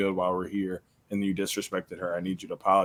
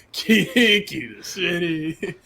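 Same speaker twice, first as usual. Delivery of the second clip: laughing; 7 semitones higher, 5 dB louder, slower than usual. Real, not acted. Laughing while riffing on a joke.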